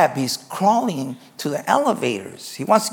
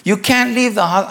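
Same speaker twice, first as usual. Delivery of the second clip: raised, high pleading calls; urgent calling out; 7 semitones higher, 5 dB louder, slower than usual. Acted. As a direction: nurses urgently calling a patient to stop. Nurses calling after fleeing patient.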